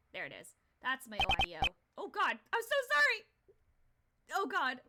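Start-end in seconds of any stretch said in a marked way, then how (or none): 2.0-4.8 high, loud, flustered outburst; alarmed apology. Real, not acted. Streamer apologising to viewers for the audio mishap, loud and flustered. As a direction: cry out a startled apology.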